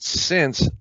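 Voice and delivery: "since" jumped high and said with weight; stress on since. Shown as a demonstration. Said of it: "But if I put".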